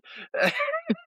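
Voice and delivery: squealing laugh; high crying laugh. Real, not acted. Laughing at innuendo.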